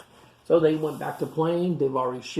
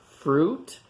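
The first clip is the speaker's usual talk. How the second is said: ordinary delivery, nothing marked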